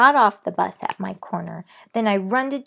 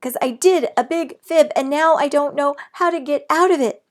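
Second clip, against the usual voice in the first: high, loud pleading; shouting; 8 semitones higher, 8 dB louder than usual. A narrator's reading. Girl shouts her fib to her mother. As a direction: shout out the confession. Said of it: "I shouted some more".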